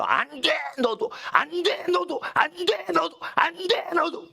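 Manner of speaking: high-pitched volley of emphatic refusals; very intense. Acted. Preacher turning each sinner away from heaven, very intense. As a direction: reject one after another, driving the pitch up. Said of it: "무지무지 강렬하게 얘기하는 거예요".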